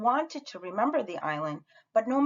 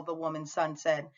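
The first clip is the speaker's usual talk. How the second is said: usual speaking voice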